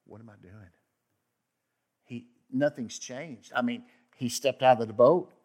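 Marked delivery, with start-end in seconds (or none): 0.0-0.7 soft, hushed self-doubt; quiet anxious murmur of Peter; worried aside. Retold or imitated. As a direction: mutter it to yourself in fear. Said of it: "I'm sure he's like".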